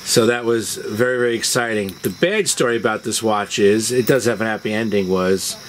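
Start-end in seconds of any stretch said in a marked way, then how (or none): none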